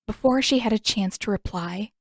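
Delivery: usual speaking voice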